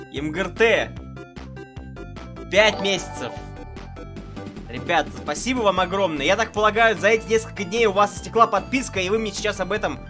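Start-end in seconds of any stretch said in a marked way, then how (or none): none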